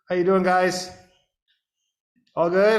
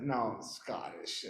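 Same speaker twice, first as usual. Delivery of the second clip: subdued reply; 8 dB quieter, much slower than usual. Retold or imitated. Guest admits to host he is Scottish, slow and subdued. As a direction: answer the host small and slow. Own sheepish reply, retold.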